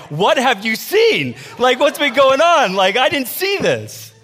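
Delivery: high, loud, drawn-out mock panic; losing her mind. [name] reacting to the neighbor's remark, frantic. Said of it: "losing her mind right now".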